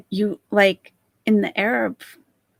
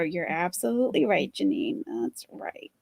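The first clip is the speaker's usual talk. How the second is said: sarcastic whisper; whispered aside; 3 semitones lower, 6 dB quieter than usual. Real, not acted. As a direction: hiss it under your breath.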